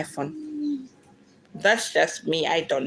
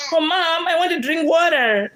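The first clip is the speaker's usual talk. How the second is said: put-on high and louder, a child asking Mom nicely; sweet child's request; 10 semitones higher, 3 dB louder than usual. Child asking her mom for water in English, high and sweet. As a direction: play the eager little kid asking Mom for water. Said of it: "And I'll be like".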